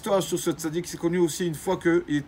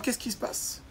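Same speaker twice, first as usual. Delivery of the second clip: whispered question; 6 semitones higher, 8 dB quieter than usual. Acted. Rabbi knocking at the neighbour's door.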